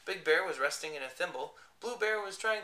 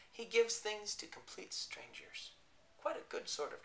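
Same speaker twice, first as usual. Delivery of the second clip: whisper; 6 semitones higher, 4 dB quieter than usual. A narrator's reading. Storyteller whispers aside.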